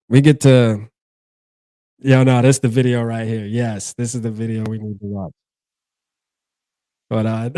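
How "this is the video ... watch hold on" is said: usual speaking voice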